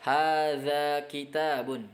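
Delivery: Hadza masjidun drawn out slowly and clearly; teacher modelling Arabic slowly; slow model reading. Shown as a demonstration. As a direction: read it slowly for students to repeat. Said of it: "model Arabic readings slowly".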